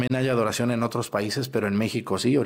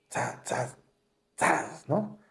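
injection swish; 4 semitones higher, 6 dB quieter, much slower than usual. Retold or imitated. Imitating the quick injection.

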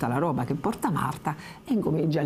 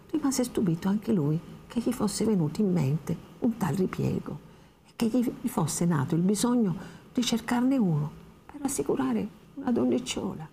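ordinary talk

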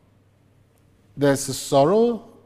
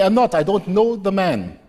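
higher and louder, almost shouting it off; heated denial; 5 semitones higher, 5 dB louder than usual. Acted. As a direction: Peter panicking, denying he knows Jesus, loud. Peter denies heatedly.

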